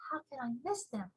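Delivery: put-on soft girlish reply; quiet small girl; little girl voice. Sophie's reply.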